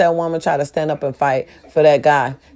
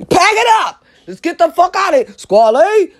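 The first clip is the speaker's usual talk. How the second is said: high-pitched rallying call; urgent calling out; 9 semitones higher than usual. Retold or imitated. Telling squad to flee, high urgent call. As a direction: round everyone up to go.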